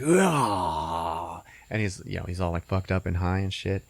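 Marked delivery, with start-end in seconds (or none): 0.0-1.4 long drawn-out "uggh"; mock strung-out groan; imitated drug-hit groan. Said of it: "he's like".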